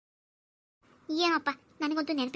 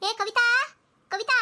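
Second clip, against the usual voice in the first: calling out; 7 semitones higher, 4 dB louder, slower than usual. Acted. Mother-in-law calling daughter-in-law.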